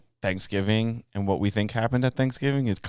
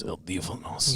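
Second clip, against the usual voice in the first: Spanish voice; 3 semitones lower, 6 dB quieter than usual. Shown as a demonstration. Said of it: "That's my Spanish voice".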